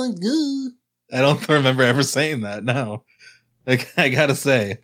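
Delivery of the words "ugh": mock whine. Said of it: "Ugh, who are these people?".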